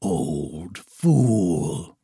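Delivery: low, scornful dragon. A narrator's reading. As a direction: sneer it like a dragon. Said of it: "he cried".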